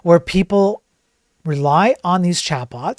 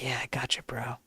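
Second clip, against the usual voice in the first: hushed, soothing sycophant whisper; mock AI whispering agreement; whispered chatbot voice; 5 semitones lower, 10 dB quieter than usual. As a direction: breathe it like a fawning yes-man bot. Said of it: "sycophantic L L M is like".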